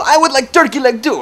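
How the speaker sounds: high, loud teasing Russian impression; mock Russian accent. Joker mocking the impatient customer to the lady, Russian accent. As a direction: order loudly in a put-on Russian accent. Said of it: "saying things in that accent".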